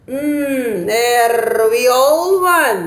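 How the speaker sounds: very slow, drawn-out musing voice; pondering Dodo. Acted. Dodo deciding the winner, slow and thoughtful. Dodo thinks with his finger.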